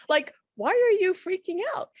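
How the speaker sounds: very high-pitched retold question; incredulous. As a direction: ask it baffled by the overreaction. Retells friend questioning worried Alan.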